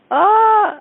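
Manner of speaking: long, high, loud "ahh"; loud re-enacted cry of reluctance; mock wail. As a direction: let out a drawn-out high groan of dismay. Reacting to the question in retelling.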